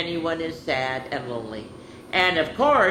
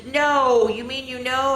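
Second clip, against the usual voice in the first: incredulous; 10 semitones higher, 4 dB louder than usual. Retold or imitated. Neighbor amazed that Tasha knows the doll, incredulous. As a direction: wail it high in amazement.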